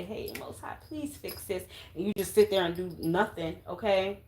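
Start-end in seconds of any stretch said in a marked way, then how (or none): 0.0-1.6 whispered, pleading mock prayer. Tarot reader mocking viewers who just pray, in a whisper. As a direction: mimic a lazy prayer under your breath. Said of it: "You don't say".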